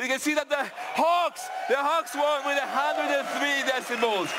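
high, projected winner announcement; excited announcement. Real, not acted. Presenter announcing Hawks won the noise contest, excited. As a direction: proclaim the winner high over the crowd.

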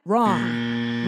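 Wrong said in a very deep, low voice; a booming low Wrong, like a verdict; mock deep voice. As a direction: drop the voice way down for Wrong. Answers own question, very deep.

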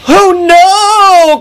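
high, loud cry of alarm. Acted. Freight cars on the runaway train, crying out. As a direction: draw it out in dismay. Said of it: "cried the freight cars".

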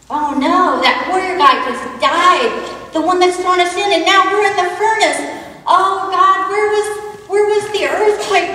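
alarmed outcry. Acted. Thrown into the furnace, crying out.

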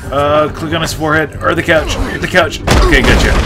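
loud rushed fight calls; frantic excitement. Real, not acted. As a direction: call out the moves frantically. Frantic button prompts in fight.

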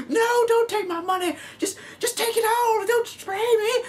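hammed-up high-pitched begging not to be sprayed; mock terrified victim. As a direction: beg like a cartoon victim, voice way up. Acted victim pleading, very high.

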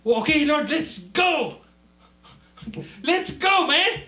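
high, loud rallying yell; hyped-up shout. Acted. Preacher acting out zeal, high hyped shout. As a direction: shout it like a hype man.

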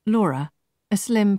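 ordinary delivery, nothing marked

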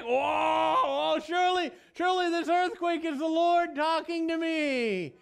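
high, loud amazed shout; shouting whoa, sure this is God; awed shout. Pretend. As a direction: cry out in wonder at the earthquake. Preacher acts out awe at earthquake.

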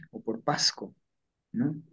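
normal talking voice

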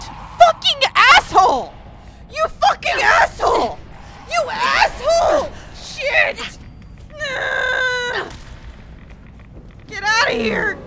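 high, ragged screamed insults; raging at the screen; furious yelling. Real, not acted. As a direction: scream abuse at the enemies. Cursing enemies in game.

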